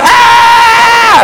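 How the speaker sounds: put-on kids screaming. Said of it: "kids going".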